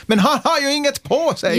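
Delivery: put-on high-pitched child's exclamation; child calling out. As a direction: pipe up like an astonished little kid. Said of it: "ett barn som säger".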